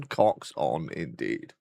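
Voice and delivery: mock deep voice. Teasing chat about being posh, low mock-grand voice. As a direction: drop the voice low like a pompous lord.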